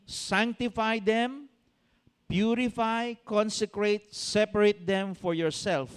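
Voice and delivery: very slow, emphatic reading; scripture reading. Acted. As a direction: stretch each word of the verse. Reads scripture slowly. (mabagal at mariing pagbasa)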